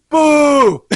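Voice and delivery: Boo! shouted loud and high, mock heckler; retold boo shout. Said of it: "all of a sudden you just hear".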